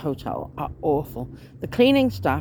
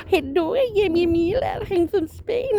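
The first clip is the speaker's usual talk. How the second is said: much higher, slow, sugary mock greeting; fake charming impression; slower than usual. Mocking the fake hotel worker's charm, high and slow. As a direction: put on a sickly sweet charm. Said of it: "She was so charming when you go".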